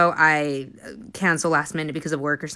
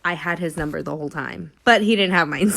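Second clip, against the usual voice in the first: ordinary delivery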